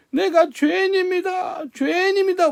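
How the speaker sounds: high, pressed cry of confession; 높은 목소리로 부르짖듯이. Retold or imitated. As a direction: wail it out high. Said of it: "막 부르짖는 거예요".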